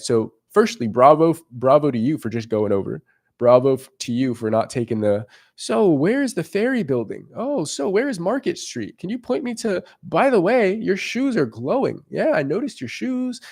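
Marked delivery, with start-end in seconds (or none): 5.6-13.4 higher-pitched, mock-innocent sing-song asking; hesitant pickup pretext. Guy pretending to ask a girl for directions, mock-innocent. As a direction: put on a high, falsely casual voice.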